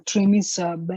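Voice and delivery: ordinary delivery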